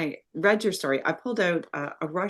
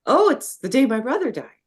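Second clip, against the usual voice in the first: realization quoted; 8 semitones higher, 7 dB louder than usual. Retold or imitated. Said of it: "And then I would go".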